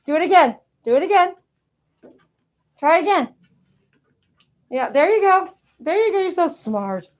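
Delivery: excited coaxing. Real, not acted. Owner coaxing the pet into its trick, high and cheerful. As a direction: egg the pet on with cheerful lift.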